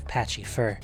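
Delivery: usual speaking voice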